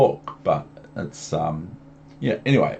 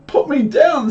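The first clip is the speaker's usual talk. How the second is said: squeaky fish protest; 5 dB louder than usual. Acted. Fish being held up, squealing high to be put down. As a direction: wail it like a fish dangling in the air.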